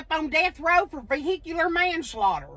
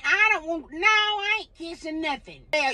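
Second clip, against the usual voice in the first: ordinary delivery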